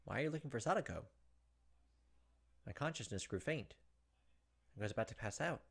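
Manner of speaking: reading the novel's text with quiet dread; tense story narration. Acted. Streamer reading Higurashi narration to viewers, tense narration. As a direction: narrate it like a creeping horror story.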